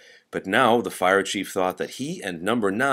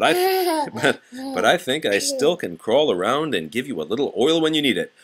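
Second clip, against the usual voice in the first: laughing; 6 semitones higher, 3 dB louder than usual. Acted. Old Jeff laughs through pain.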